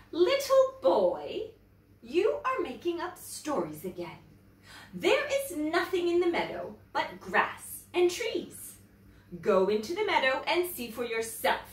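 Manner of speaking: lightly voiced mother correcting her child; scolding mother. A narrator's reading.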